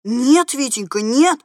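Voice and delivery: understated startled protest; mildly frightened cry. A narrator's reading. Lady stopping the boy's dynamite trick, alarmed cry. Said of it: "в испуге вскрикнула спецдама".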